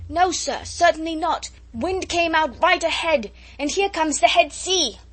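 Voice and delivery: high, loud shouted report. A narrator's reading. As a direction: shout the answer up to the captain over the wind. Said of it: "converse with raised voices as people quarrel".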